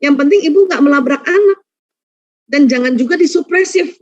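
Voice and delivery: usual speaking voice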